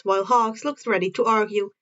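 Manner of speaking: plain reading voice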